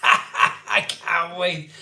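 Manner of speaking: laughing through it, slowly; giggling. Real, not acted. Vlogger giggling about meeting his hero. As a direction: let giggles spill over the words.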